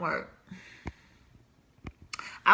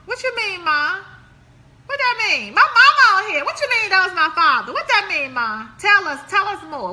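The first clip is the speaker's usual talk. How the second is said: higher, louder playful prodding; teasing shout; 7 semitones higher, 6 dB louder than usual. Real, not acted. To her mother in the comments, teasing and loud. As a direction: needle your mother, loud and high.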